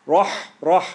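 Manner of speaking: slow, loud Bismillahir with a full, heavy "Rah". Shown as a demonstration. Teacher demonstrates the heavy letter for the class. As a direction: open the mouth wide for a heavy Rah. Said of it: "It's heavy".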